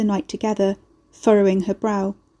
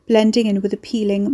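plain reading voice

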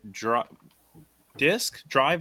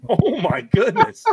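laughing; 11 semitones higher, 7 dB louder, slower than usual. Real, not acted. Laughing at the disc.

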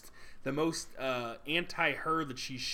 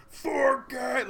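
high, loud, overpowering command; imitated movie villain's demanding shout; creepy, demanding voice; 11 semitones higher, 8 dB louder than usual. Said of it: "very creepy, like, demanding, overpowering voice".

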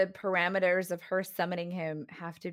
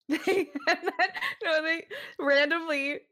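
words squeezed out through laughter; laughing while talking; 7 semitones higher than usual. Real, not acted. To her cohost, laughing through the words. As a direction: giggle through the start of the sentence.

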